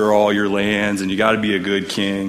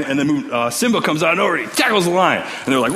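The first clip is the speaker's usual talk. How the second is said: ordinary delivery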